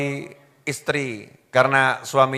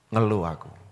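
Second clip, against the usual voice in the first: sulky husband's sigh; 6 semitones lower, 8 dB quieter than usual. Retold or imitated. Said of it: "Beda sama laki-laki".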